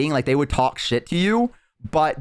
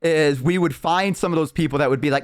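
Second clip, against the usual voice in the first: normal talking voice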